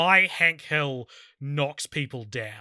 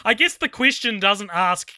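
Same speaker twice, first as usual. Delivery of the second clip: ordinary talk, nothing marked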